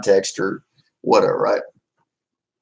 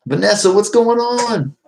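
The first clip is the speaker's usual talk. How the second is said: excited greeting; 11 semitones higher, 4 dB louder than usual. Real, not acted. Greeting viewer by name, high.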